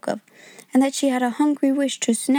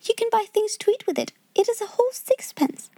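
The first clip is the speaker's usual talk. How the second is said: put-on much higher, earnest child's voice; earnest little boy; 11 semitones higher than usual. A narrator's reading. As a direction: proudly stress how much he gives. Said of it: "he insisted stoutly".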